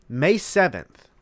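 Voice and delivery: ordinary talk, nothing marked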